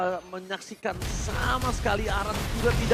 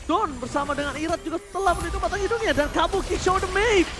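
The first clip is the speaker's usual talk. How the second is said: loud, fast, higher hype casting; excited play-by-play; 6 semitones higher, 6 dB louder than usual. Acted. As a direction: call the teamfight at full energy. S0 casts a teamfight live.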